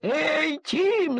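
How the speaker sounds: anxious mother calling her duckling back; worried call. A narrator's reading. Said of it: "забеспокоилась утка".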